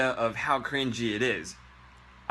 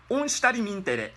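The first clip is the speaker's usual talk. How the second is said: instrumental in a much higher mock Japanese accent; 10 semitones higher than usual. Shown as a demonstration. Teacher mocking English in a Japanese accent. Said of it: "that's what it sounds like".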